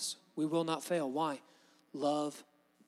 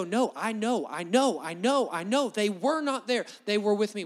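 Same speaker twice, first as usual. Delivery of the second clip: louder, higher insistent pleading; passionate insistence; 4 semitones higher, 5 dB louder than usual. Retold or imitated. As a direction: defend them like you won't back down. Imagined passionate defense.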